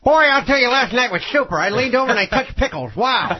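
put-on loud, high old comic voice; Morey Amsterdam impression. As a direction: do a brassy old-time comedian. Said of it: "Who does Maury Amsterdam?".

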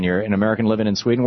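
usual speaking voice

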